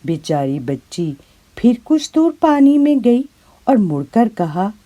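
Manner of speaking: plain reading voice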